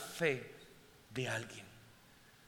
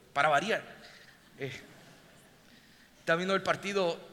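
ordinary delivery

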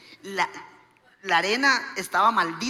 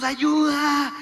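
raised, loud mock distress call; calling for help; 5 semitones higher, 6 dB louder than usual. Couple begging onlookers for help, acted calling. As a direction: call out to people who just stare.

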